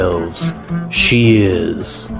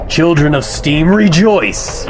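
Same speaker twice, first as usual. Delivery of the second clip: rallying cry; 6 semitones higher than usual. Acted. Radio host rallying listeners.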